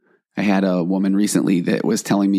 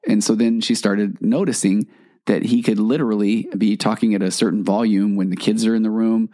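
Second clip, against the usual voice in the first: normal talking voice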